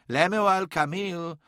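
Mahavastu addressing the pair. A narrator's reading. Old man calls two bickering companions to attention, restrained rebuke. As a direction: address them by name as an elder about to scold. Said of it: "said Mahavastu from the palanquin".

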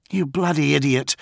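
rescued man yelling at his rescuer; angry yell. A narrator's reading. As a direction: vent fury at a reckless friend. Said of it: "John yelled".

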